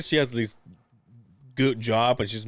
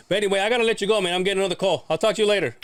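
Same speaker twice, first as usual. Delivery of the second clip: higher, louder hurried goodbye; dad brushing off; 8 semitones higher, 4 dB louder, faster than usual. Retold or imitated. Son imitates dad cutting the birthday call short, higher and louder. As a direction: wrap it up fast like a busy dad.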